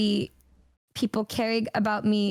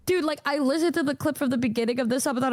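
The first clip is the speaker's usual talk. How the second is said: ordinary delivery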